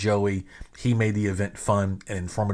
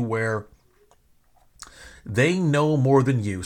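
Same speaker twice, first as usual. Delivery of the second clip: ordinary talk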